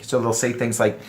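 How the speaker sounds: ordinary talk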